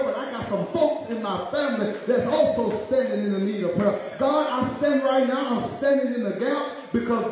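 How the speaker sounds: pleading prayer rising in pitch; prayer cry. Acted. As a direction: cry out to God for the family. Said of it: "holler out and say".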